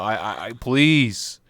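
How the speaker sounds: normal talking voice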